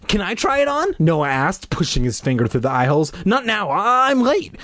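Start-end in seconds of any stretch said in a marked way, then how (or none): 0.1-0.9 put-on high boy's voice; little brother. Noah asked to try the mask, high little-brother voice. 3.2-4.5 higher, brisk refusal; sharp reply. Acted. Sister refusing her brother's request, sharp. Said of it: "she replied sharply".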